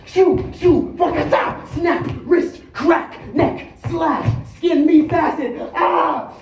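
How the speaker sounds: high, explosive comic-book battle cries; wild action shouting, very loud and high; shouted fight moves. Acted. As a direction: yell each blow as it lands. Acting out frantic fight moves.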